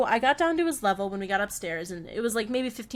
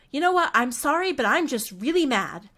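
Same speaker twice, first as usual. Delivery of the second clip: higher, emphatic mock-mad child; imitating Alex's anger; 4 semitones higher, 5 dB louder than usual. Boy telling his nanny he's mad, imitated annoyance. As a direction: act out a child owning up to being mad. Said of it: "he'll get really mad about something".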